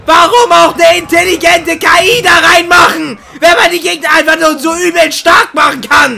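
loud furious rant; berserk rage. Real, not acted. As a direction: shout it in pure frustration. Raging at the game.

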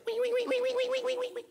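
high squealing wee wee; piglet squeals. Acted. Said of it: "the pig goes like this".

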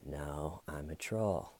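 very quiet, low deadpan imitation; hushed, unbothered mock replies; troll mode. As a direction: mimic the suspect trolling, low and barely voiced. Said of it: "Staven's just in troll mode".

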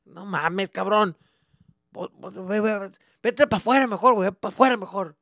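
loud, high Spanish scolding; exasperated dad yelling. Retold or imitated. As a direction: send the kid outside, fed up.

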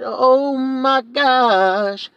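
high, very slow stretched "Oh my gosh"; drawn-out oh my gosh. Shown as a demonstration. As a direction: drag out "Oh" long and high in mock awe. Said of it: "I said".

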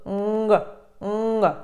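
"Нг" as nasal NG with an audible G release; isolated, repeated "нг" ending on hard G; nasal plus G. Shown as a demonstration. Teacher showing learners the added G sound. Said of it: "ще звук г".

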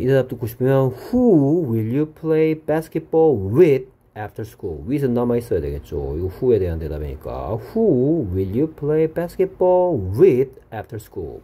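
ordinary delivery

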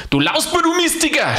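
high, furious scolding; angry yell. Acted. Alois yells at the cyclist, high angry yell. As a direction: shout at the red cyclist. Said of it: "schrie er".

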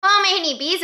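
bright, very high sung-out hello; high singsong greeting. Real, not acted. Cheerful vlog opening greeting.

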